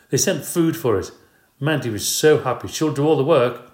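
husband pleading that Mandy will do the work; eager pleading. Acted. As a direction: sell her on it.

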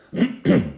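loud throat clear. A narrator's reading. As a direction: clear your throat pointedly.

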